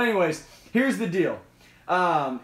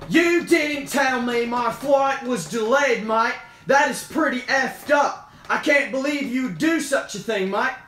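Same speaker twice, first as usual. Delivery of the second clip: higher-pitched, indignant mock Aussie; hammed-up Australian customer complaint; Australian accent; 5 semitones higher, 3 dB louder than usual. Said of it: "I'll try to do an Australian".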